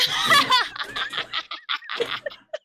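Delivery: shrill gleeful cackle; high cackle. Acted.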